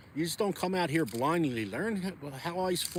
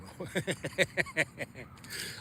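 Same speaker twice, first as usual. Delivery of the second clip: long rattling run of chuckles; real laughter; 5 dB quieter than usual. Real, not acted. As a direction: laugh it off in a rapid string of ha-has. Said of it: "I laugh".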